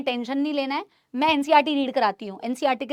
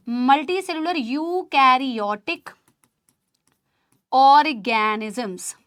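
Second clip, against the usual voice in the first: "Multicellular eukaryotic organisms" drawn out slowly, word by word; slow dictation; slower than usual. Shown as a demonstration. Teacher dictates the definition for students to write, slow. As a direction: spell it out slowly for note-taking. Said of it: "बताओ।".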